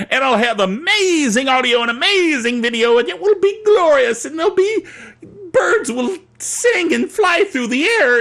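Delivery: high-pitched, giddy gushing; gushing rapture. Acted. Host daydreaming of his new camera, gushing rapture.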